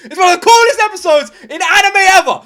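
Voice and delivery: loud, high, ecstatic yelling; excited shouting. Real, not acted. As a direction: yell out in pure hype. Shouting hype at climax.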